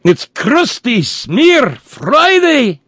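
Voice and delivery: very high, loud, slow Scottish proclamation; Scottish accent. Pretend. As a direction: bellow it like a hammy Scot. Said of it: "my Scottish accent".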